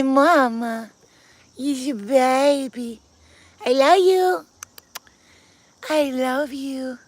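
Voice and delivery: high, drawn-out cooing. Real, not acted. Greeting and cooing to cat.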